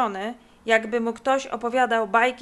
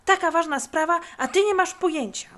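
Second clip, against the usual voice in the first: high, loud scolding; acted furious reproach; shaking with anger; 8 semitones higher, 5 dB louder than usual. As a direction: burst out in exasperation. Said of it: "aż zatrząsł się z gniewu".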